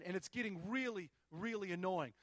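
usual speaking voice

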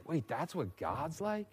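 soft, slow, incredulous question; hushed disbelief. Acted. Pastor asking congregation about God, hushed and slow. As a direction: let it fall away in quiet surprise.

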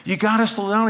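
high, urgent protest; mock upset complaint; retold protest. Retold quarrel on the boat.

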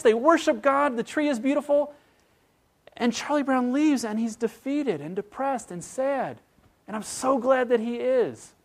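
fervent preacher's climax; impassioned preaching. Acted. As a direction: preach it out with rising fervor. Preaches at full force.

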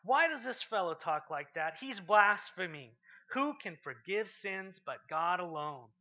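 loud, slow outraged accusation; scandalised teachers of the law; booming, drawn-out indignation; indignant Pharisees. Retold or imitated. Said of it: "thinking to themselves".

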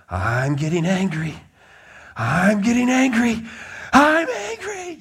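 mock tantrum climbing to a piercing high squeal; imitated kettle-whistle anger. Preacher imitates frustrated kindergartner building to full-blown whistle, comic rage. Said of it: "until it gets full, full-blown whistle".

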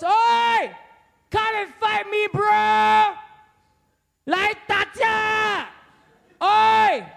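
high, loud, drawn-out battle call; taunting challenge shout. Acted. Giant challenges soldiers at the front line, shouted taunt. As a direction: bellow a cocky dare across the lines.

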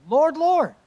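anguished plea from the crowd; pleading crowd. Acted. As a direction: cry out like the rejected begging at the gate. Voicing the pleading crowd in Scripture.

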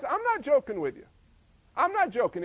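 ordinary delivery

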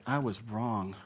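soft, slowing plea for forgiveness; mean every word, gently; tender apology to someone you hurt; sincere plea. Shown as a demonstration. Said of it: "look somebody in the eye and say".

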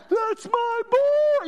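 high, loud, long-held holler; screaming dad. Retold or imitated. Preacher imitating his dad cheering at the game, drawn-out scream. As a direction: imitate a proud dad bellowing from the top stands. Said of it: "he'd scream it".

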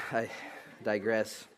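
ordinary talk

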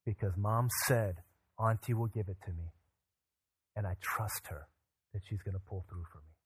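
soft, low, settled voice; quiet trust. Retold or imitated. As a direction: lightly imitate a child's calm trust, hushed. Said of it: "and said".

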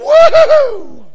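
huge, high, loud cheer; joyful yell. Retold or imitated. Overjoyed young man shouts in worship. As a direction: explode with joy.

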